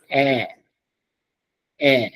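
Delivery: "air" as a long open French è; open E sound. Shown as a demonstration. Teacher demonstrating accent grave sound, long open "air". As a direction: stretch "air" as a pure open vowel. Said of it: "it's pronounced as air".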